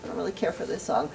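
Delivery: whispered shrug of a line; unimpressed. Retold or imitated. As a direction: brush off a new song under your breath. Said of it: "You're kinda like".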